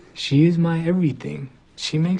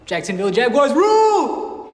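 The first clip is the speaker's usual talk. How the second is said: a stadium cheer dropped into a love poem; sudden shout; 5 semitones higher, 4 dB louder than usual. Acted. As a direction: burst out shouting mid-poem.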